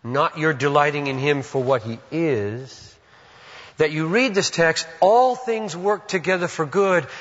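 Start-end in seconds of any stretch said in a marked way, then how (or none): 3.8-7.1 building to a high, loud pulpit climax; mounting, high-pitched exhortation; impassioned preaching. Real, not acted. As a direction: drive the point upward, pitch climbing to the end. Preaching builds to full force.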